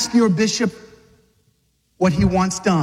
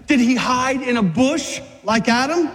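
ordinary delivery

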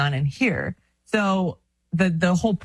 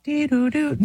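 high sing-song radar beeps; radar alert noise; 9 semitones higher than usual. Shown as a demonstration. Showing a friend the radar going off, playful beeping. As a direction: imitate a little alarm going off in a high voice. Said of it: "my radar goes off".

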